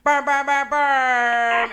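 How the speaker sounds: loud, buzzy air-horn chant of burn; air horn imitation. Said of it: "I just went".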